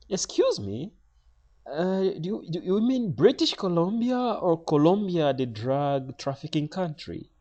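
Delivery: high-pitched official's query; airline lady. Retold or imitated. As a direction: put on a high, questioning official voice. Voices airline lady questioning him.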